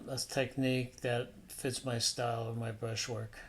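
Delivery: normal talking voice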